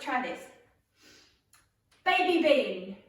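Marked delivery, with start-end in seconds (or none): 2.0-3.0 loud game command; called out. Shown as a demonstration. Leader starts the round for the players, loud call. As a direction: project it across the whole group. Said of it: "so when I call broad bean".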